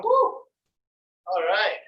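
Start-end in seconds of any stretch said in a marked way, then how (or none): 0.0-0.1 whooping cheer. Real, not acted. Cheer after exercise finishes.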